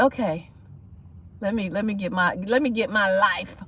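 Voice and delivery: low, soft retold resolve; quietly gather yourself, voice dropped; resigned self-talk. Retold pulling herself together.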